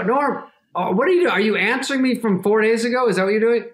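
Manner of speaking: high, incredulous questioning; retold exasperation. Friend replying to a days-late answer, retold exasperation. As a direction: press him in mock disbelief.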